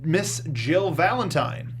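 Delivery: normal talking voice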